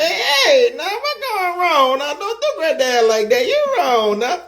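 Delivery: put-on high, laughing old grandpa voice; squeaky old granddad pleading with a laugh; Grandpa Otis voice. Shown as a demonstration. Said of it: "Talk in your Grandpa Otis voice".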